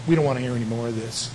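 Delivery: soft, scoffing brush-off of the resurrection; a lightly voiced sneer, waving it away; mocking dismissal. Athenian scoffers brushing off Paul, in a soft mocking voice. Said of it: "from those that said".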